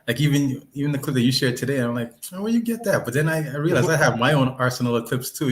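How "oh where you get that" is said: high-pitched mock puzzlement; retold surprise. Friend recalling reaction to shared clip, surprised. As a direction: act out your own surprised question.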